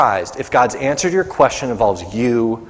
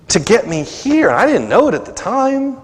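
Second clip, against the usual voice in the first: ordinary talk, nothing marked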